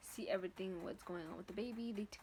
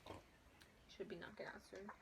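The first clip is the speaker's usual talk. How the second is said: whisper; 3 semitones lower, 12 dB quieter than usual. Real, not acted. Baby just given a bottle.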